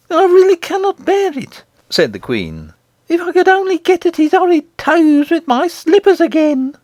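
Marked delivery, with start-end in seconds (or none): 0.1-1.6 very high, put-on queen voice; fed-up queen, pitched far up; exasperated goblin queen. A narrator's reading. Queen complains at the taunting song. 3.1-6.7 very high, put-on queen voice; irritated queen, pitched far up; vengeful goblin queen. A narrator's reading.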